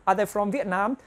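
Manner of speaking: normal talking voice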